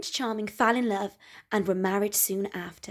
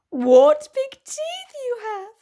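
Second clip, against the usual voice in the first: put-on high, slow little girl; much higher, wide-eyed girl; 14 semitones higher, 7 dB louder, slower than usual.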